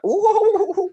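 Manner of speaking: high, loud "ooh" like a too-hot shower; imitated clumsy crying wail; mock dad's cry. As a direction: yelp out dad's awkward cry, high-pitched. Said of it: "the shower's too hot or something".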